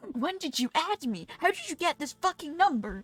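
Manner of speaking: real shocked swearing; incredulous outcry. Real, not acted. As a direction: demand it in disbelief. Flood of friend requests.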